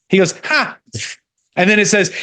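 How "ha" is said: a higher, sharper mocking "Ha!"; scoffing laugh. The Lord laughing at schemers, high scornful scoff. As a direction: toss off a high derisive laugh. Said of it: "That's a laugh and a scoff".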